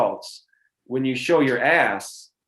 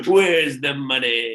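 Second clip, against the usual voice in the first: slow, sly villain voice; mustache twirling villain; 4 dB louder, slower than usual. Pretend. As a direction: gloat over the money like a cartoon villain. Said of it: "you're the evil landlord".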